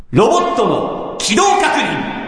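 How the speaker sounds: slow drawn-out title call; announcer title call. Acted. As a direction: announce it slowly like a show title.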